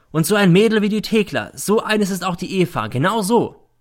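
high, heated comparison; spiteful accusation. A narrator's reading. Mother likening Eva to Thekla, spiteful. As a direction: press the insult home. Mother attacks girl in quarrel.

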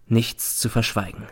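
plain reading voice

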